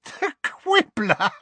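high, slow laughing exclamation; cackling scorn; whooping laugh. A narrator's reading. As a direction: hoot it out in disbelief. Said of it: "a great whoop of laughter".

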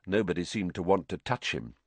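plain reading voice

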